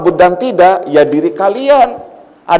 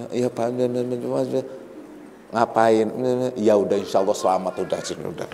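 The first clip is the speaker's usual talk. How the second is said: soft polite replies; 6 semitones lower, 12 dB quieter, faster than usual. Retold or imitated.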